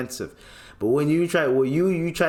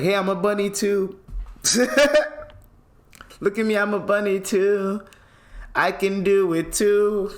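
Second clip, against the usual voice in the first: mock bunny voice; 8 semitones higher than usual. Host mocking the guy showing off as a bunny. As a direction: play the bunny showing off to her. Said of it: "that's what you were trying to show her".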